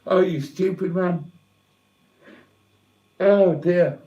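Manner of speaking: choking up mid-sentence over lost pets; words catching in the throat, near sobbing; tearful, voice breaking. Real, not acted. Streamer talking to viewers about dead pets, tearful and breaking. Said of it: "watch a fat old bloke blubber".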